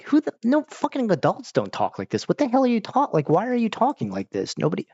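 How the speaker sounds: high-pitched, exasperated disbelief; hammed-up bewildered outburst; mock incredulous. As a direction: protest in a squeaky, baffled voice. Acts out baffled viewer's protest.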